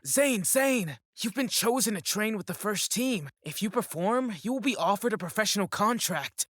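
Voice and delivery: loud, high, excited calling out; excited announcement. Acted. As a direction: run over and break great news. Manager runs over with good news.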